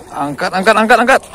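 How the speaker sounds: loud urging, climbing in pitch; urgent excitement. Real, not acted. Angler urging the catch up out of water, urgent. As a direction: urge the lift with mounting force.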